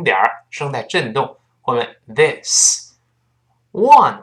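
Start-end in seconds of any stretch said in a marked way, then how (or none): 3.7-4.2 "one" with a fronted vowel like wān; wrong ONE. Shown as a demonstration. Teacher warning learners off it, wrong fronted "one". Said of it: "不要读one".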